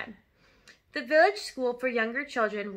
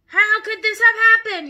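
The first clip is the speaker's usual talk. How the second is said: high, loud outraged yell; 10 semitones higher, 12 dB louder than usual. Acted. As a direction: demand an explanation from the whole room. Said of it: "he yelled".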